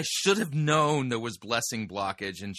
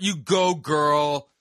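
sassy cheer; 2 semitones higher, 6 dB louder, much slower than usual. Pretend. Mock cheer.

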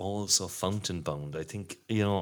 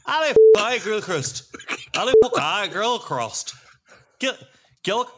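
louder and higher stab at a Scottish accent; 11 semitones higher, 9 dB louder than usual. Shown as a demonstration. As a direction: belt the name out in a Scottish accent. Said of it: "anyone do a Scottish accent there".

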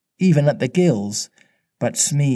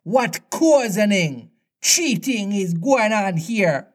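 high, drawn-out pirate indignation; outraged captain stretching his words; thundering; 7 semitones higher, slower than usual. A narrator's reading. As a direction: demand it slowly, pitched high. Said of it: "thundered Hook".

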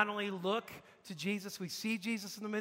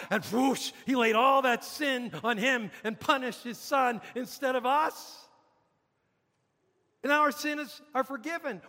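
high, loud, forceful gospel proclamation; impassioned preaching; 5 semitones higher, 4 dB louder than usual. Real, not acted. Preacher declares sins paid at the cross, impassioned preaching. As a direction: proclaim it with rising fervour.

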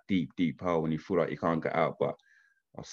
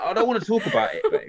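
laughing whine; 13 semitones higher, 4 dB louder than usual. Retold or imitated. His reply to his wife.

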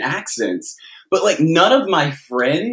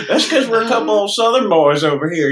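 put-on Southern accent; 7 semitones higher than usual. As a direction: lay on an old Southern boy twang. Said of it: "gotta let that Southern out".

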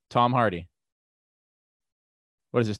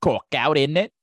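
louder, higher mock cockney; hammed-up fake British "innit"; terrible British accent; 5 semitones higher, 4 dB louder than usual. Host riffing in his bad British accent, hammed-up. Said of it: "do my terrible British accent".

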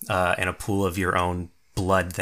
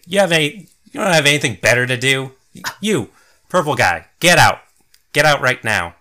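mildly scolding, fast and louder; scolding wizard; 6 semitones higher, 5 dB louder, faster than usual. Acted. Wizard scolds the bystanders and orders them out, sharp and louder. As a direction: shoo them off like a cross old wizard.

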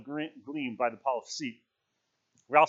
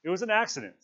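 raised, insistent statement; stubborn; 7 semitones higher, 4 dB louder than usual. A narrator's reading. Piggy insists to frightened Ralph, stubborn. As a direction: refuse to be argued out of it. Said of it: "said Piggy stubbornly".

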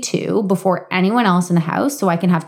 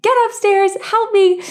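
yelling at husband; 14 semitones higher than usual. Retold or imitated. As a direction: yell down for help, losing it. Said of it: "I start yelling at him".

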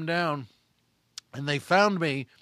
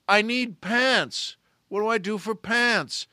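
higher, louder and slow pleading; mock desperation; 5 semitones higher, 5 dB louder, slower than usual. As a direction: beg on the phone with hammed-up panic. Desperate demand on phone.

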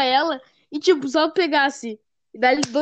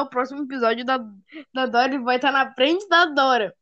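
ordinary delivery

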